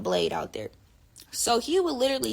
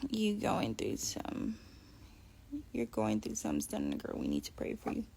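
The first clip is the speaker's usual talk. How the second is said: mock concerned viewer; 12 semitones lower, 12 dB quieter than usual. Voicing a viewer's comment.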